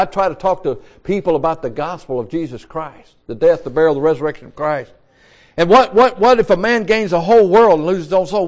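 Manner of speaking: normal talking voice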